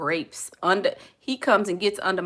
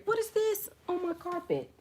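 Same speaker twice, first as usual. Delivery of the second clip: higher, softer sudden question; a startled aside, pitched up and soft; sudden surprise; 11 semitones higher, 8 dB quieter than usual. Real, not acted. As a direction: notice something odd on the floor. Spots something on the floor.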